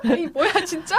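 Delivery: high-pitched laughing surprise; amused disbelief. Real, not acted. Co-host hearing the crying scene panned, amused and incredulous. Reacts to co-host's harsh acting verdict.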